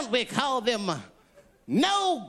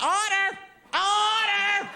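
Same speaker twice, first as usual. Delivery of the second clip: meeting chairman; 8 semitones higher, slower than usual. Retold or imitated. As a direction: call the meeting to order. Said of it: "big meeting back in the sixteen hundreds".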